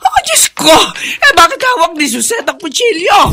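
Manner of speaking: gasped, high cry of alarm; shocked at the sight of the knife; frightened outcry; panicked. Acted. Mother sees knife, panics.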